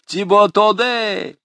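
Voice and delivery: high, loud jeering greetings; student jeering at the passing rector; mocking jeers. A narrator's reading. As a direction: heckle the rector with glee. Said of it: "воскликнул Жан Мельник".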